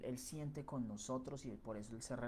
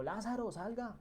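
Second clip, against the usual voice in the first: loud, much higher cry of Lázaro, salga; Jesus shouting into the tomb for his friend; calling out loud; 9 semitones higher than usual. Retold or imitated. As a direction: call him out of the tomb at full voice. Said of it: "pega el grito".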